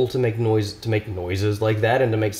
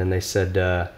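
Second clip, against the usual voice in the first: ordinary talk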